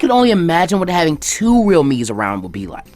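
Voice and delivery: high, loud and gushing; excited pleading. Acted. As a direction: gush about the idea, building enthusiasm. Ino begging excitedly for jutsu.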